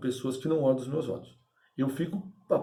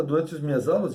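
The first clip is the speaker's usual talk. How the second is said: ordinary delivery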